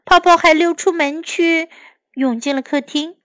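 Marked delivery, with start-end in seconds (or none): none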